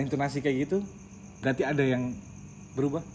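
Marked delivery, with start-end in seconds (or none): none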